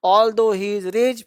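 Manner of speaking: slow, word by word; slow dictation. Shown as a demonstration. Teacher dictates a sentence slowly to students. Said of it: "फिर भी, है ना".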